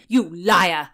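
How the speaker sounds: loud, furious "You liar!" shout; shouted accusation. Acted. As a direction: hurl "You liar!" at her.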